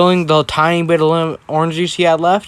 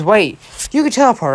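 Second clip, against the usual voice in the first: raised, higher-pitched mock yell; retold yell; 6 semitones higher than usual. Ida running over to Deku about his teleporting, mock yelling. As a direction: call out sharply, half-yelled. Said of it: "yelling at him, says".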